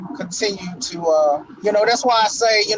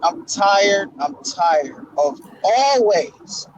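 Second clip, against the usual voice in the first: usual speaking voice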